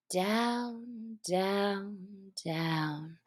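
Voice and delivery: slow, soft, drawn-out repetition of down; drawn-out falling. A narrator's reading. Narrator draws out Alice's endless fall, slow and soft.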